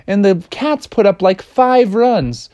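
ordinary delivery, nothing marked

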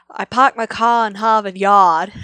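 slow, loud Boston accent; loud, deliberate Boston accent demo; loud Boston accent, showing it off. Shown as a demonstration. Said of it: "And the accent is, let's see if I can do it".

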